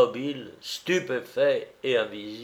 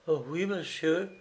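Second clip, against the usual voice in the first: high, soft, obedient reply; meek servant; 5 semitones higher, 5 dB quieter than usual. A narrator's reading. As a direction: answer the master small and high. Fired servant answers master meekly.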